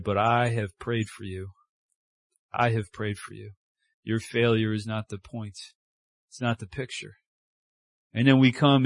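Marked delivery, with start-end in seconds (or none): 0.0-7.1 soft, low reassurance; gentle Jesus. Acted. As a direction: say it quietly, like comfort. Jesus gently warning Peter.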